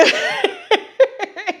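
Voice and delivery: high real laugh breaking the act; burst of laughter. Real, not acted. Admitting to viewers she was lying, real high laugh. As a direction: laugh out at your own fib. Laughing at her own fake.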